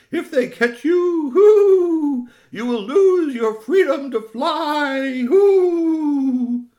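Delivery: high, loud owl wail; hooting owl parent. Acted. Owl parent warns of losing freedom, high drawn-out hooting. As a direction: chant it slowly with long hoots.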